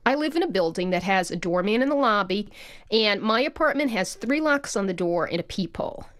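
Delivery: ordinary talk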